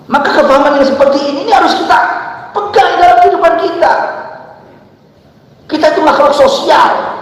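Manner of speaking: high, loud sermon voice; fervent preaching. Acted. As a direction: drive the point home to the crowd. Preacher raises voice.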